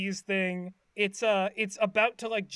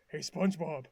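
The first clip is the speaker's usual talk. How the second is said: low, softened character voice; Patrick; 5 semitones lower, 4 dB quieter than usual. Retold or imitated. Patrick at the bedroom window at night, low eerie greeting. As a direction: drop low for a creeping greeting. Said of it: "and he's like".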